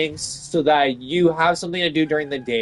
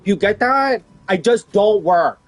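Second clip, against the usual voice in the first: loud, high, insistent imitation of a co-worker; mock defiant yelling; 6 semitones higher, 7 dB louder than usual. Imitating a co-worker who refuses to do any work, defiant yelling. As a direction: hammer out each refusal, mimicking an obstinate colleague. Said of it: "that's the kind of talk that I get".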